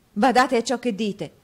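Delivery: loud, high, sharp caution; alarmed warning. A narrator's reading. Nicola warning Newman about his words, alarmed warning. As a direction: warn him with real urgency. Warns friend amid shocking news.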